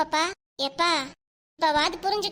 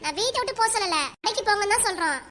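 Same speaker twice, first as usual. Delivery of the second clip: urgent call; 5 semitones higher than usual. Acted. Wife calls back leaving husband.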